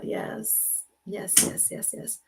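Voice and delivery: breathy whispered yes, yes, yes; whispered yeses. Real, not acted. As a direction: whisper your agreement to yourself. Whispered agreement again.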